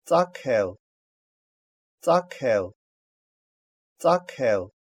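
"Цакел" with a puffed "к"; voiceless "к" mid-word. Shown as a demonstration. Teacher giving an example for learners, puffed "к" in "Цакел". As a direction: say "Цакел" distinctly, breath after the k. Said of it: "читается аке".

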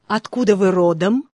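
ordinary delivery

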